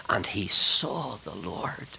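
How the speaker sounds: slow, low, half-whispered wonder; hushed awe. Real, not acted. Preacher recounting Isaiah's vision of the Lord, in awe. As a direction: let it fall to a reverent hush.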